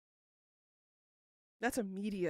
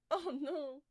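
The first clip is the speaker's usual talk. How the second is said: teary; 3 semitones higher, 4 dB quieter than usual. Real, not acted. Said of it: "Oh, God, I'm crying".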